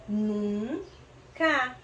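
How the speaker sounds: "Nunca" split into nun, ca; syllable by syllable. Shown as a demonstration. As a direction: sound out "Nunca" with a held first syllable. Sounding out the syllables slowly.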